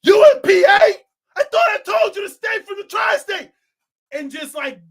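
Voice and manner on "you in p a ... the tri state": high, loud confrontational yell; hammed-up shouted disbelief; rival's outraged shout. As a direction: shout it across state lines at an enemy. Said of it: "my op in New York goes".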